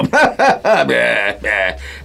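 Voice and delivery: high chuckling laugh; gleeful laugh. Acted. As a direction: laugh with delight at the heist. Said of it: "sonríe divertido".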